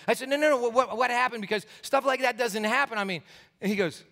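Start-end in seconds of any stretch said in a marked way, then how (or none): 0.3-3.0 high, agitated retold protest; incredulous insistence. Teenager protesting to pastor about miracle, incredulous. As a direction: insist it can't be, amazed. Insisting such healing cannot happen.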